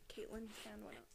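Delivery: a soft, breathless whisper. A narrator's reading. Narrator repeating the line as a hushed aside.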